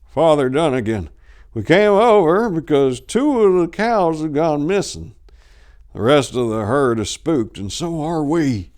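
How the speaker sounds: lightly voiced old farmer, worried; old farmer explaining. A narrator's reading. Old man telling the priest cows are missing, worried.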